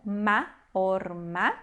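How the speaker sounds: "ma" alone, then "orma" split or-ma; demonstrated reading. Shown as a demonstration. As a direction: sound out "ma", then "orma" with a tonic final A. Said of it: "a gente leu aqui como". ("ma" isolado, depois "orma" com A tônico)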